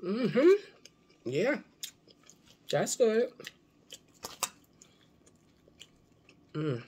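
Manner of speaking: talking between bites; chewing while talking. Real, not acted. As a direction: mouth partly full. Seen 0: Jaw and lips chew after the fish bite at -7s.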